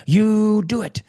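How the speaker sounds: put-on high, loud insistent old lady voice; an old woman pressing a young man, emphatic; old woman urging. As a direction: urge him like a fierce elderly woman. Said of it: "she came over and she's like".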